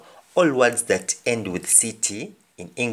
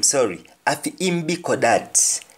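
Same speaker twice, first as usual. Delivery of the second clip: Jamaican patois, "afi im" said as "afi ĩm", slightly raised; Jamaican patois example; 3 semitones higher than usual. Shown as a demonstration. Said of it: "Maybe you're talking about a dog or cat".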